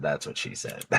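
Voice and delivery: hushed whispered punchline; whispering. Retold or imitated. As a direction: whisper the quote under breath. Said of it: "Maybe Jody should be the one whispering".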